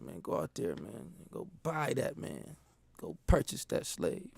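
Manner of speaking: low, hushed, almost whispered instruction; quiet order. Retold or imitated. Muhammad quietly sending a man to buy the slave, hushed. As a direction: drop it down to a hushed command.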